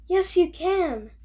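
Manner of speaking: mock angry insisting. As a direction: push back like a playful quarrel. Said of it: "they're both angry".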